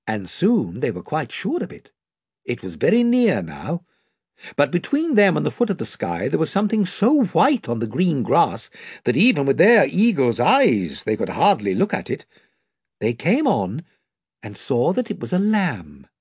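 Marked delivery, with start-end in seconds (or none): none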